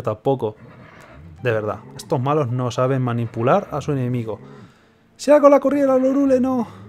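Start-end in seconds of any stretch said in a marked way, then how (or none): 5.2-6.8 much higher theatrical princess. Acted. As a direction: deliver it like a stage heroine. Reading game dialogue aloud.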